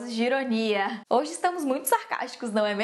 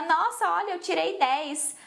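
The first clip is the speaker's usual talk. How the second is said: imitated boast, higher pitch; bragging classmate; 4 semitones higher than usual. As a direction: play a classmate showing off a top grade. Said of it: "e aí vinha". (colega se gabando, tom mais agudo)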